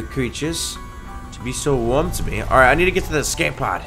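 normal talking voice

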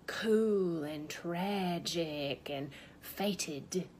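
very slow, drawn-out mock-doomed words; mock cool tragic. Wife mocks the songs about leaving, mock cool tragic. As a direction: stretch each word as if romantically fated. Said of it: "making it sound so, like".

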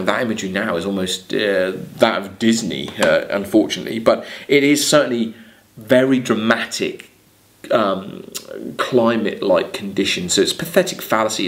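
usual speaking voice

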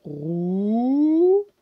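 "Route" called out loud and slow while writing; loud drawn-out word. Shown as a demonstration. Teacher writing "route" in joined-up letters for pupils. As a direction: stretch "Route" out loudly as you write it. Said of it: "je vous montre".

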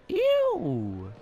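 high, loud "ew" sliding down; drawn-out cry of disgust; disgusted squeal. Real, not acted. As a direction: squeal in revulsion, starting way up high. Disgusted by what is found.

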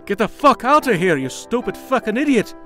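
heated, high-pitched snapping; angry outburst. Acted. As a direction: drive them away in exasperated fury. Lover drives ex away in quarrel.

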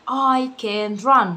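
very slow, each word apart; slow classroom drill; slow teaching read. Shown as a demonstration. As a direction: drill it slowly for a child. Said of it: "ادي انا كررتها مرتين".

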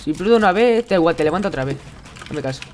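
usual speaking voice

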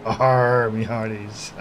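loud growled pirate arr. Acted. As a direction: ham up a salty pirate. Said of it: "pirate voice anymore".

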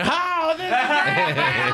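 high, laughing onlooker voice; mock the crowd's amused laughter; imitated laughing. Onlookers enjoying the monkey attack, imitated high laughter. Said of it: "they're all laughing".

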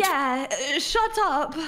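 mocking imitation. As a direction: parody him, laughing as you go. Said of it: "He's like".

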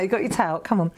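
ordinary talk

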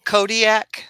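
KODIAK said high and loud; showing pronunciation. Shown as a demonstration. Co-host shows how to say the name, "Kodiak" high and loud. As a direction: pronounce "Kodiak" out clearly and loudly. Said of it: "how you say that word again".

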